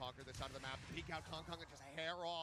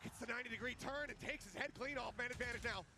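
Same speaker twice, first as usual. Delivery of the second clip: higher hype call; esports caster; 8 semitones higher, 16 dB louder than usual. Real, not acted. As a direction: ramp up the clutch.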